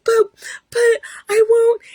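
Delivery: very high, stammered tearful squeak; mock crying. Wife protesting to husband, hammed-up crying. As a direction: imitate a weepy, helpless objection. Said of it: "I'm still crying and I'm still upset".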